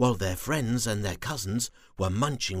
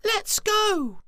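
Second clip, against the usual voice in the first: very high eager little worm; small worm voice; 6 dB louder than usual. Acted. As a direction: chirp it out eagerly. Squiggy eager to go.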